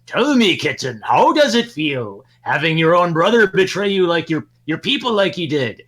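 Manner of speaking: high, loud, over-the-top villain gloating; self-important villain. Acted. Co-host mocks the comic writer by reading his villain's speech, hammy villain. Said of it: "I'm gonna read this in the voice".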